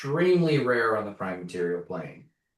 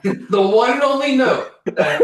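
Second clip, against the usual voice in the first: high, loud words through laughter; laughing; 14 semitones higher, 15 dB louder than usual. Real, not acted. Player teasing the table's note-taking, laughing hard. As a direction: talk while cracking up.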